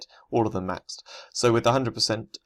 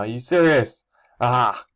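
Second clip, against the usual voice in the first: frustrated loud yell; sudden loud outburst; 3 semitones higher, 7 dB louder, slower than usual. Real, not acted. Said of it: "my little outburst there".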